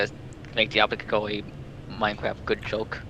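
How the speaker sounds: ordinary talk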